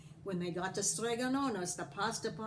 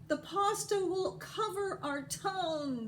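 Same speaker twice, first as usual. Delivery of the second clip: high, slow, despairing wail; a lament of a doomed town; crying out in fear; 11 semitones higher than usual. A narrator's reading. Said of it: "they cried".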